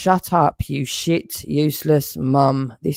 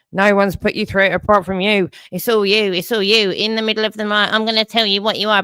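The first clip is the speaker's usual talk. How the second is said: higher, sneering mimicry of his accuser; mocking imitation; 5 semitones higher, faster than usual. Ranting man mocks the accuser's own words, lightly sneering. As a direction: parrot his words back with scorn.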